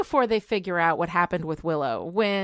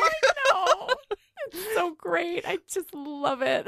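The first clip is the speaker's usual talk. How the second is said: laughing; 8 semitones higher, slower than usual. Real, not acted. Gushing laugh over Giles scene.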